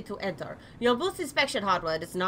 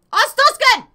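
high, loud proclamation; patriotic shout; 13 semitones higher, 12 dB louder than usual. Pretend. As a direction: hail a countryman at full voice. Proclaiming a countryman to be admitted.

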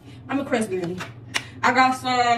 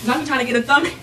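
laughing while posing to get a thumbnail, giggling; faster than usual. Real, not acted. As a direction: say it through a giggle.

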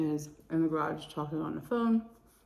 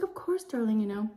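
sweet server; 5 semitones higher than usual. Retold or imitated. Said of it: "She was so sweet".

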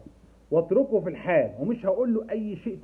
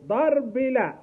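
raised firm command, higher pitch; loud firm no; 7 semitones higher, 3 dB louder, much slower than usual. Shown as a demonstration. A therapist stops a child hitting, firm loud no. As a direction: stop the child with a sharp firm no. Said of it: "اقول له".

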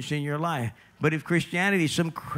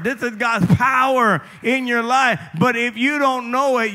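loud, raised, driving declaration; full-force preaching; 6 semitones higher, 7 dB louder, slower than usual. Acted. Preacher declaring scripture as his own vow, full-force preaching. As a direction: preach it out slowly and forcefully, every phrase landing.

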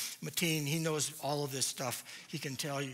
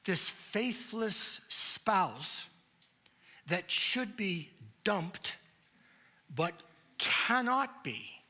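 very slow, each phrase held apart; drawn-out pondering delivery; slow, weighty; 2 semitones higher, slower than usual. Acted. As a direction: let the words hang, slowly and heavily. Dwelling on God's dilemma.

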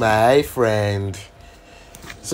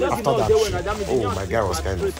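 scared; 11 semitones higher than usual. Retold or imitated. Friend spooked by spirit nearby.

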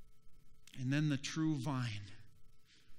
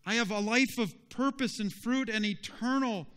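higher, louder loving appeal; pleading gardener; 5 semitones higher, 4 dB louder than usual. Acted. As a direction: call out your love to the branches. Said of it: "the gardener is saying".

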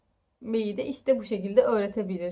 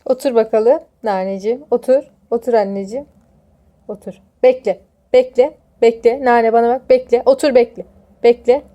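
louder firm pet commands; firm commands to a cat; 9 dB louder than usual. Real, not acted. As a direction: tell the cat to sit and wait.

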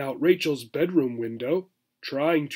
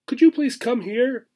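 high, loud, bright plea; cried out, smiling; 9 semitones higher, 5 dB louder than usual. A narrator's reading. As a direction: call out eagerly with a smile. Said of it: "cried Obi, smiling".

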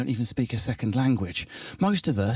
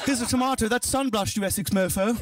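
higher-pitched, hammed-up posh heckle; posh Suffolk heckler; 6 semitones higher than usual. Suffolk Abs heckles with a tomato, mock-posh. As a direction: play a genteel heckler hurling insults. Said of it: "gonna heckle me with a quail's egg".

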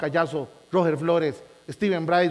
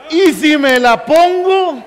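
high, loud, slow wail; dramatic outcry; 9 semitones higher, 12 dB louder, slower than usual. Acted. Rival fan dreading wearing the purple shirt, slow loud wail. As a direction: moan it like it's torture.

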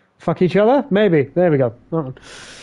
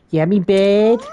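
Scouse "me bed", a touch louder; Scouse accent; 3 semitones higher, 3 dB louder than usual. Shown as a demonstration. Streamer tries Scouse phrases for chat in a Scouse accent. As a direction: lean into the Liverpool vowels. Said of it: "Such a Scouse phrase".

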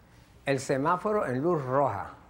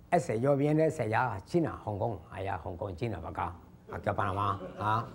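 mock Chinese accent; 7 semitones lower than usual. Traveller explains his origin to immigration, halting mock Chinese accent. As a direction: fumble through it in a put-on Chinese accent. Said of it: "llega un chinito al aeropuerto".

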